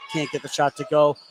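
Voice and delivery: ordinary talk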